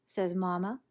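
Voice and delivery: ordinary delivery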